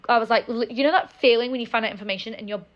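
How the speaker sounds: ordinary delivery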